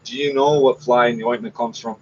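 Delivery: normal talking voice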